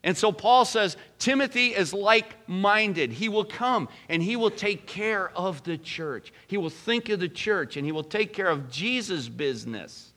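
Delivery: high, loud preaching that builds, then settles back down; full-force preaching. Acted. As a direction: preach it out hard to the congregation.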